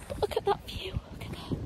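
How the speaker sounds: soft whisper near the houses; hushed. Real, not acted. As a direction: whisper so the neighbours won't hear. People's houses there.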